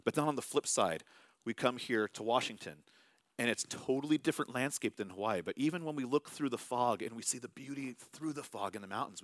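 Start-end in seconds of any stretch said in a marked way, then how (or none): none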